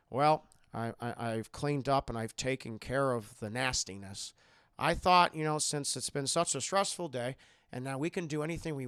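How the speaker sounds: low, softened retold voice; a touch of measured, low calm; lightly voiced calm man. Captor tells her he has cleaned up, lightly voiced, low and calm. Calm creepy Howard, acted.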